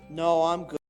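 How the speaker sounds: ordinary talk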